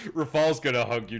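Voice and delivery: normal talking voice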